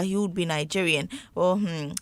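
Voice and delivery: usual speaking voice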